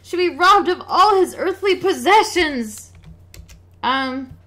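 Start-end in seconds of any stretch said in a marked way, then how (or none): 0.0-2.9 a robbed merchant, woeful and louder; lamenting merchant. Acted. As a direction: bewail his losses like a ruined trader.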